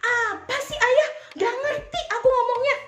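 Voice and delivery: put-on a child snapping at her father; rude whiny retort. Shown as a demonstration. Child snaps rudely at father, high and loud whiny. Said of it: "Bukannya malah".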